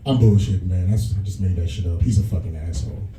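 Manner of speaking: usual speaking voice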